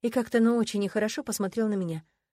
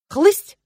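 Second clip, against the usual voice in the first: slap sound; 10 semitones higher, 15 dB louder than usual. Acted. Звук пощёчины, звонкий.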